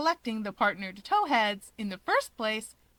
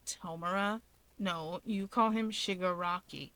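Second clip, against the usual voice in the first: lower, softer and curt; low gruff Dabi; 3 semitones lower, 5 dB quieter than usual. Acted. Dabi correcting his brother, low and curt. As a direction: correct him flatly, unimpressed.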